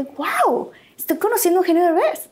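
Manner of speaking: retold fan thrill; starstruck. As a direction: gush at meeting a star. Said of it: "Y yo así de".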